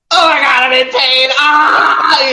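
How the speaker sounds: high, loud kid's cry of pain, hammed up; mock hurt wailing. Imitating kids who fell once noticed, mock wailing. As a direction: wail like an overdramatic hurt child. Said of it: "suddenly they're like".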